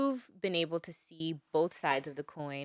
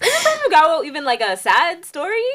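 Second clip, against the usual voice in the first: laughing; 11 semitones higher, 11 dB louder than usual. Real, not acted. Laughing question about the song.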